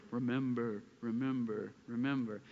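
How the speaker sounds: low, soft, fading echo of the words; echoing fade. Retold or imitated. Pastor echoing Mufasa fading in the sky, low soft echo. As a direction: let each remember roll away like a voice in the sky. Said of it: "those rolling words".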